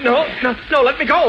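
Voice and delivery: high, frightened struggling plea; panicked protest. Acted. Doctor grabbed by Superman begging release, panicked protest.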